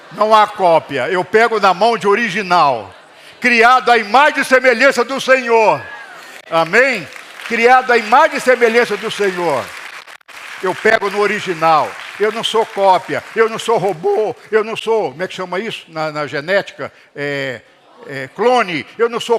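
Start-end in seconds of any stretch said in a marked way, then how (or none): 0.1-1.1 loud, hammered repeat of não há cópia; louder emphatic repeat. Shown as a demonstration. Preacher repeating to the congregation, loud emphatic. 1.1-15.1 loud, fervent pulpit cry; full-force preaching. Acted. Pastor rousing the congregation, loud fervent preaching.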